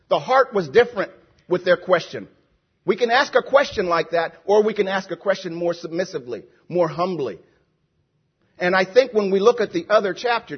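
normal talking voice

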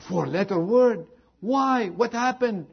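high-pitched, incredulous questioning; shocked mother. Retold or imitated. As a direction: act out a mother taken aback. Mother reacts shocked to daughter's report.

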